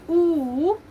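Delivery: "oo" stretched into a long vowel; drawn-out, stressed "oo"; longer oo sound. Shown as a demonstration. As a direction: hold "oo" longer. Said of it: "you make a longer sound".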